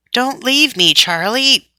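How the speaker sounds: high, pleading call after a friend. A narrator's reading. Hurt boy calling his friend not to leave, high and pleading. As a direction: call him back, pitch up high. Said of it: "called out Bert".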